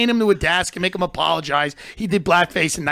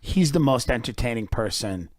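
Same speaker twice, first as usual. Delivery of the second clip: usual speaking voice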